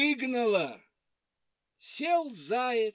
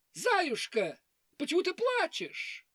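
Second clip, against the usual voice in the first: high rooster voice; 9 semitones higher than usual. Acted. Rooster asks.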